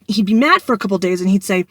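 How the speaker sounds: usual speaking voice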